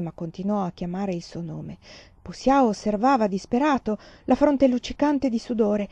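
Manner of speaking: ordinary delivery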